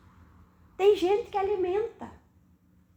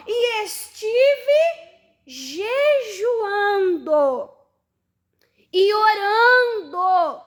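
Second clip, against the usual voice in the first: slow, choked reading, louder, voice near crying; tearful scripture reading; 4 semitones higher, 6 dB louder, much slower than usual. Acted. Preacher reading Nehemiah's fasting and prayer to the church, slow and tearful. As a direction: let the words catch in the throat as you read them. Reads verse emotionally, slow.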